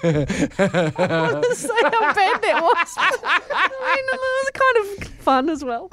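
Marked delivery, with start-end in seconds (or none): 0.0-4.1 laughing. Real, not acted. Laughing after the silly shanty.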